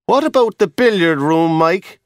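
a lightly voiced guard being helpful. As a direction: offer the idea helpfully.

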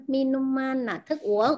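ordinary delivery

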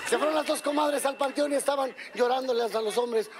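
normal talking voice

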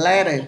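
"letter" with a d-like middle sound; American flapped t. Shown as a demonstration. Teacher showing how Americans say it, flapped t in "letter". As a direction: say "letter" roughly as "le-der". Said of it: "Người Mỹ thì sẽ có xu hướng phát âm".

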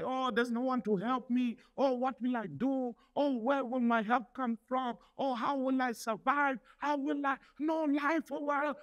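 mock the anxious voice lightly; whiny worried mock. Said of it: "Don't talk like that".

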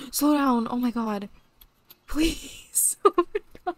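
normal talking voice

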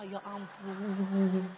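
slow, long wailed plea, imitating sobs; mock weeping prayer. Preacher retells crying in prayer at Gethsemane, mock wail. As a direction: drag the last vowel into a hammed-up sob. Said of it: "saya nangis-nangis di Taman Getsemani, saya berdoa".

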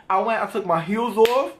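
usual speaking voice

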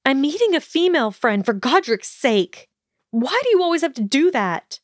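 fast, high-pitched exasperated protest; offended outburst. A narrator's reading. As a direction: fire back indignantly, pitched high and hurried. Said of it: "she barked with offense".

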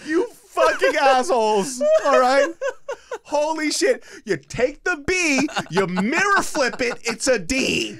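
high, loud, slow ranting; hammed-up yell, stretching the words in exasperation; mock outrage. Friend ranting at the parents over one letter, mock outrage.

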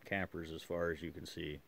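ordinary talk, nothing marked

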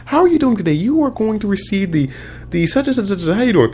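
very high-pitched mock reporter voice; imitated lady interviewer. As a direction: put on a high, chirpy red-carpet interviewer. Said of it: "this lady walked up and interview".

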